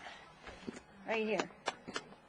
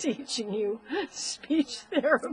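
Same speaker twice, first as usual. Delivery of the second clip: laughing; 4 semitones higher, 5 dB louder than usual. Real, not acted. Laughing at memory.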